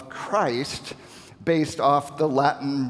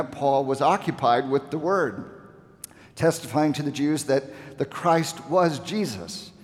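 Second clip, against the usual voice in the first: normal talking voice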